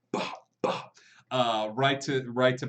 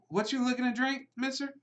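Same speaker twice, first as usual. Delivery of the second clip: put-on young bartender; 11 semitones higher than usual.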